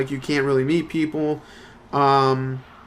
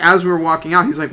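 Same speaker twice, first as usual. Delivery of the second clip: normal talking voice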